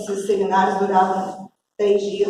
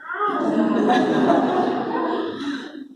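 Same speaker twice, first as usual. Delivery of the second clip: a short breathy burst of laughter; amused laugh; 7 semitones higher than usual. Real, not acted. Preacher laughing at her own cheeky reply.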